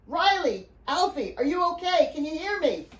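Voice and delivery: high, urgent call from a distance. A narrator's reading. Father calls down to the children, urgent calling. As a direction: call out anxiously to find them.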